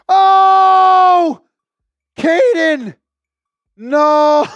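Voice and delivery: high, loud yell of disbelief; stunned shout. Real, not acted. Host reacting to Caden's big super chat, stunned shout. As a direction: cry out in real shock at the news.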